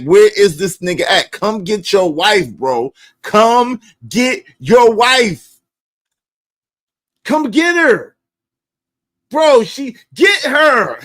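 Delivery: loud, pleading shout; exasperated yelling. Retold or imitated. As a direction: yell for someone to come get her. Begging friend to fetch wife.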